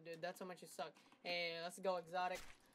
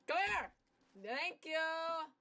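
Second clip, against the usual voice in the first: high, loud yell at the enemy; excited shout; 9 semitones higher, 8 dB louder, much slower than usual. Real, not acted. Chasing an enemy player, an excited high shout. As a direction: yell it mid-fight.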